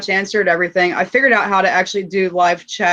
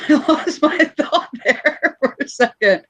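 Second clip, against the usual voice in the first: laughing; 6 semitones higher, slower than usual. Real, not acted. Laughs while losing her thought.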